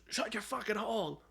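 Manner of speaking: high-pitched yell without much volume; mock angry yell. Villain answering the noise from below again, mock angry yell. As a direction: imitate the villain's irritated outburst, held back.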